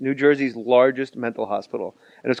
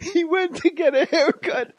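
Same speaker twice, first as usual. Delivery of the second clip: high, slow, giggling through the line; laughing; slower than usual. Real, not acted. Co-host repeats the funny line, laughing hard. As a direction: repeat it while cracking up. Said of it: "It's like the funniest sentence".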